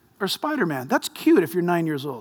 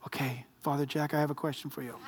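quiet mimicry; 7 semitones lower, 15 dB quieter than usual. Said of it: "son-in-law's reply quoted".

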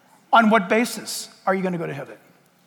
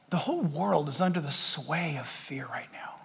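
hushed, breathy warning; whisper; 8 semitones lower, 10 dB quieter than usual. Acted. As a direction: lean in and whisper it.

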